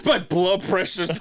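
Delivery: ordinary talk, nothing marked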